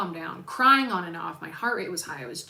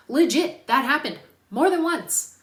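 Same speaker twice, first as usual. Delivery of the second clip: higher and louder, exasperated; indignant exclamation, pitched up; outraged burst; 6 semitones higher, 9 dB louder than usual. Real, not acted. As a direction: blurt it out in disbelief. Outraged about puppy amputations.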